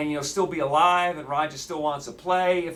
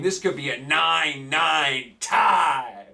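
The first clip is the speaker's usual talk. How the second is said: ordinary delivery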